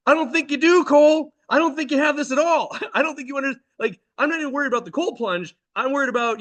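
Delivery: high-pitched, alarmed parental objection; worried protest. Retold or imitated. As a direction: reenact a dad arguing in rising worry. Alarmed dad argues with son.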